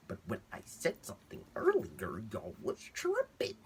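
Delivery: jumps high and squeaky on the last words; mock exasperated. Patient to doctors, a high exasperated squeal.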